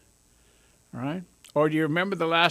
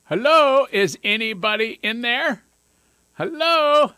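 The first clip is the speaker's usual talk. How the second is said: loud, high mock call into an empty head; calling hello; 6 semitones higher, 7 dB louder, slower than usual. Someone checking if you're there, loud teasing call. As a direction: shout hello as if knocking on a skull. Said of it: "someone walks up to you and goes".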